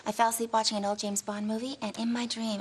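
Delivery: ordinary delivery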